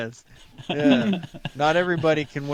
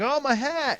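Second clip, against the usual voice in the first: panicked whine; 14 semitones higher than usual. Pretend. Imagined panicked friend.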